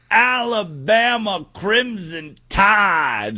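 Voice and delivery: high, drawn-out hype shout; Pat McAfee impression. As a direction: stretch out the team name in a big, high sports-host holler. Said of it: "do my best Pat McAfee impression".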